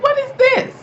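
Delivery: high, shrill-pitched "what is this"; outraged disbelief. Retold or imitated. Reacting to the nanny still being hired, high and outraged. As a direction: shoot way up in outrage. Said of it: "I'm like".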